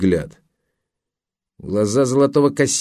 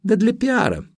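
put-on much higher, guileless girlish reply; charming naivety; 13 semitones higher than usual. Woman explaining herself to the photographer, charmingly naive. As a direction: pitch it way up, artlessly innocent. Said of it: "с очаровательным простодушием ответила она".

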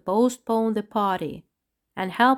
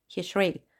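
plain reading voice

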